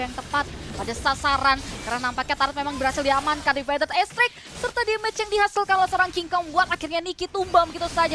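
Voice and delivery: loud esports call; excited play-by-play. Real, not acted. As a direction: ramp up for the double kill. Live play-by-play of kills. (komentar seru)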